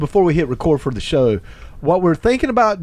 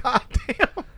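a higher, softer laugh through the words; laughing disbelief; 8 semitones higher, 6 dB quieter than usual. Retold or imitated. Narrator laughing at Cotton's excitement.

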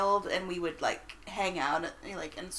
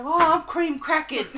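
higher, louder Cockney imitation; Cockney stepdad; 8 semitones higher, 10 dB louder than usual. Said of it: "very Cockney accent".

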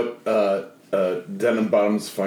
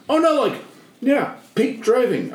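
higher, breezy mock driver voice; lightly voiced lady driver; 11 semitones higher than usual. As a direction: brush it off like the driver. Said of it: "And she goes".

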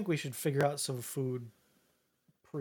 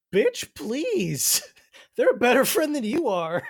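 high, loud mock indignation; sassy comeback; 7 semitones higher, 7 dB louder, slower than usual. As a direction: clap back at the scoffers, high and loud. Acted indignant comeback to scoffers.

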